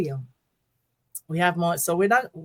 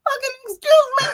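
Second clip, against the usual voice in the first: high, loud mimicked yell; running-joke outburst; 5 dB louder than usual. Two friends' inside joke when getting somewhere, mock yell. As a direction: squeal out the imitation, far above usual pitch. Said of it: "Def and I go".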